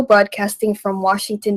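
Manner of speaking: normal talking voice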